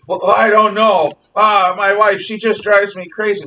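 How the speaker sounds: loud, high, hammed-up lament; exasperated husband, pitched high and loud; pirate with love problems. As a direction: wail like a lovesick pirate in a comedy. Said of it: "thirty-year-old pirate".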